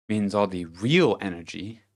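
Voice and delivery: ordinary talk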